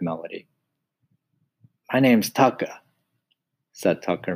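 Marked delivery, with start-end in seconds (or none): 1.9-2.8 lightly voiced mouse. Tucker introduces himself.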